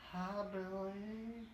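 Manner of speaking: a strange woman greeting him after silence; blue lady. Acted.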